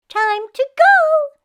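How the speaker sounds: high, loud, slow bat; high squeaky bat announcing leaving; small bat voice. Acted. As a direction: play the tiny bat, very high. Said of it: "said the bat".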